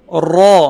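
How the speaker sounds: را full-mouthed with a trilled ر; rolled heavy ra. Shown as a demonstration. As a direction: roll the ر of را and fill the mouth. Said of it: "را کی آواز بھی خا کی طرح موٹی". (منہ بھر کے لرزتی را)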